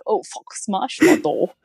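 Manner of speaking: a light, slowed mock of a cursing team boss; Gunther swearing. Mimicking the TV team boss's cursing for the co-host, lightly voiced and slow. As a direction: toss off a half-hearted angry-boss rant, slow. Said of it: "Drive to Survive Gunther".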